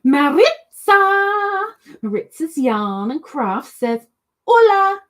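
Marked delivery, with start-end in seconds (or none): 0.0-1.7 calling Maritza, higher, loud and slow; loud greeting. Real, not acted. Calls out a chat name. 4.5-5.0 loud greeting. Retold or imitated. Reads a greeting loudly.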